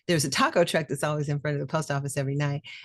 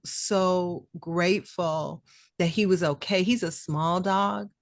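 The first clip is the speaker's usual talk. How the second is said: usual speaking voice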